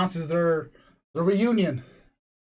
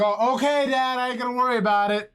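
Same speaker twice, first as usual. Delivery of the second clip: son calling back from across the way, pitched up; raised voice; 7 semitones higher than usual. Retold or imitated. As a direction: call out to dad in another room. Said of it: "that's why I raised the voice".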